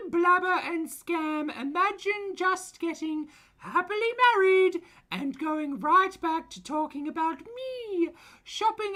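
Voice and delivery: high, loud, slow taunting screech; mock her like a shrieking online bully; foodie voice. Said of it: "in foodie voice".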